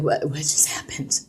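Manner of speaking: mock bewildered whisper, slow; stunned whisper. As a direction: imitate a dazed graduate hearing no, in a whisper. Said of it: "they're like".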